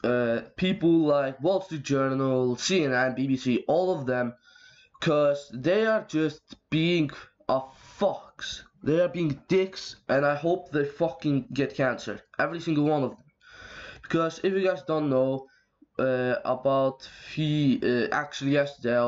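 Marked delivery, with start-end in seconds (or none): none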